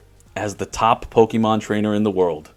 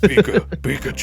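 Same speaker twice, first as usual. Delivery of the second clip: muddy boomy voice; 2 semitones higher than usual. Acted. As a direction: say Pikachu with too much bass. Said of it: "too much bass in your voice".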